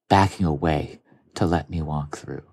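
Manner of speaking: normal talking voice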